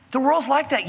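normal talking voice

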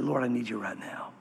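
a hushed prayer, barely voiced; whispered prayer. Shown as a demonstration. Preacher models a whispered prayer for the congregation. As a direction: breathe it out as a whispered plea. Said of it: "Some of you need to say that right now".

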